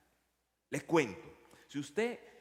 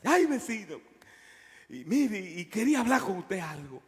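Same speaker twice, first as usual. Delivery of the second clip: a lightly voiced, higher, louder timid neighbour; pleading neighbour; 4 semitones higher, 5 dB louder than usual. Downstairs neighbour at the door, raising a touch higher and louder. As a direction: nervously stir up the nerve to complain.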